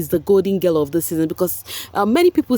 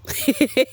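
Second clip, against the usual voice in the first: high laugh. Real, not acted. Laughing at gossip.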